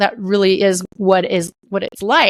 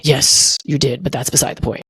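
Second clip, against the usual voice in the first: God's voice again; 9 semitones lower than usual. Retold or imitated. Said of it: "And he would say".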